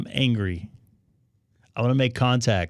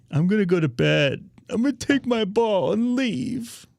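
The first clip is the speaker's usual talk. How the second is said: mock pouting; 9 semitones higher, slower than usual. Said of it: "But don't be angry".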